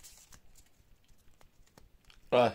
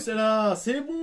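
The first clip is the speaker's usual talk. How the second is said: excited; 9 semitones higher, 5 dB louder, slower than usual. Real, not acted. Excited at the Pikachu card.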